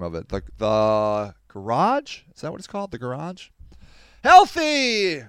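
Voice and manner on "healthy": excited. Real, not acted. Reading good news aloud.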